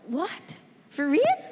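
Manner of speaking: disbelieving question with a sharp rise at the end; retold disbelief. Telling how she reacted to the TV show's text, disbelieving. Retelling her reaction to the call.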